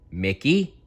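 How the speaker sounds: ordinary delivery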